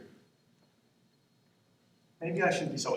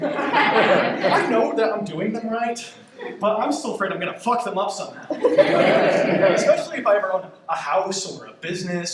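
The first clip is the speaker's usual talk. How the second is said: louder, laughing through the words; laughing while talking; 4 semitones higher, 7 dB louder than usual. Real, not acted. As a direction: laugh nervously through the confession. Nervous laughing about doing taxes.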